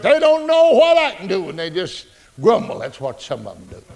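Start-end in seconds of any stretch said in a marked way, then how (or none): none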